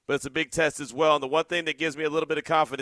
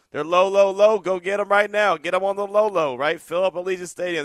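excited; 5 semitones higher, 7 dB louder than usual. Real, not acted. Host hyping low ticket prices.

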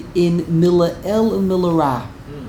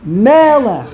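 Melech with a loud, drawn-out first syllable; first-syllable stress; 3 semitones higher, 5 dB louder than usual. Shown as a demonstration. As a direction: stretch and punch ME in Melech. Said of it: "the emphasis is on the first".

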